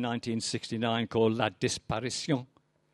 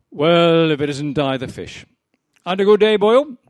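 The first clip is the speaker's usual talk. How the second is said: loud, higher hearty barman greeting; Welsh barman; 5 semitones higher, 11 dB louder than usual. Acted. Barman greeting the fisherman coming in, loud and hearty. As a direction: boom a cheery welcome across the bar.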